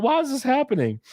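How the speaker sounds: very high, distressed plea; high-pitched retold despair; mock panicked whine. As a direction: act out helpless stoned bewilderment. Acting out stoned panic at movie.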